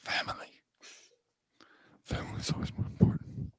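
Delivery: solemn hushed whisper. Acted. Son telling his father family matters most, hushed. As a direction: whisper it like a heartfelt vow.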